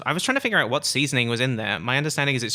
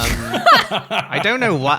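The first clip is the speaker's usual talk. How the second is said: laughing; 9 semitones higher, 8 dB louder, much slower than usual. Real, not acted. Laughing at her own urge.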